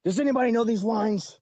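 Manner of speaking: mock exasperated yell at the cast; pissed-off director. Director fed up with an actor flubbing lines, angry. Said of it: "and John was pissed".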